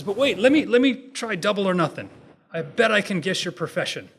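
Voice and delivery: loud, high-pitched eager counter-bet; urgent challenge. Acted. Shepherd stops Smith to offer double or nothing, urgent challenge.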